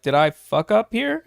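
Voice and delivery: worried self. Retold or imitated. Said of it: "my initial reaction was like".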